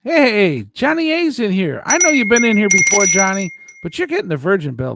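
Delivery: loud, high, fast hail to a newcomer; high-energy shout-out; excited greeting. Real, not acted. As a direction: call out a big rowdy welcome. Calls out greeting to arriving viewer.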